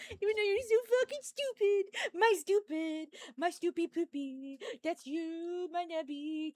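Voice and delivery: high cooing pet voice. Real, not acted. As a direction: coo at the dog adoringly.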